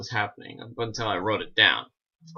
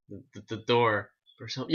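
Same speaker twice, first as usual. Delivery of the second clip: ordinary talk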